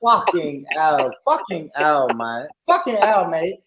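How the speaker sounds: loud, emphatic fake British accent; hammed-up British swearing; put-on British accent. Shown as a demonstration. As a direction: exclaim like a put-on Brit. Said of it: "Do another accent".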